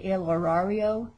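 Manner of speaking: "horario" in a Montana accent, English R's. Shown as a demonstration. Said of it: "that's the Montana way to".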